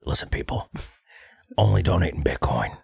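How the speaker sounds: hushed then urgent conspiratorial pitch; Alex Jones impression. Podcast host urges Bitcoin donations, mock Alex Jones. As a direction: lean in like a paranoid radio host. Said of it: "I'm Alex Jones".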